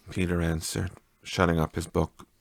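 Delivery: ordinary delivery, nothing marked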